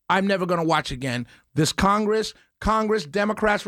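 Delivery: normal talking voice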